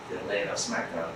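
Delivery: barely voiced, falling. Shown as a demonstration. Teacher repeating the point under his breath. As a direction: let it sink to a murmur. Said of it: "repeated even softer".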